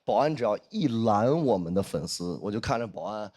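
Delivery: normal talking voice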